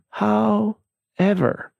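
"however" stretched very slowly, high-pitched; drawn-out "however". Real, not acted. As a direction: drag out "however" for dramatic suspense. Word repeated, drawn out for effect.

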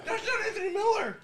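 high laughing shout at the game; excited shout. Real, not acted. Watching football, excited.

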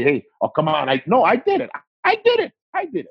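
mocking a self-pitying whine that peaks very high; mock victim whine. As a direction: play the self-pitying victim, voice rising to a squeak. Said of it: "the victim mentality for me is like".

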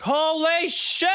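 high, drawn-out mock freak-out; mock panic. Imitating everyone panicking when things got canceled, high and slow. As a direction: squeal it like a panicking crowd.

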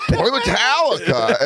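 high, slow, laughing demand; mock meth-head caller. Caller demanding Metallica, high, slow and laughing. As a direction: drag out the request, high and giddy.

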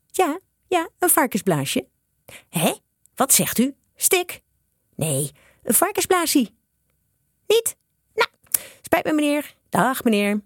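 high, put-on telephone voice; polite prank caller. A narrator's reading. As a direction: play an innocent customer on a poor line. Prank phone call, feigned customer. (hoge, beleefde telefoonstem)

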